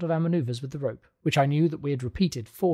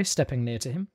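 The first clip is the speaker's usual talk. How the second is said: ordinary delivery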